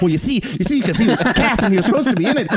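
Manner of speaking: mock over-eager nerd explanation; eager explaining. Fan explaining a casting joke to his clueless wife, excitedly.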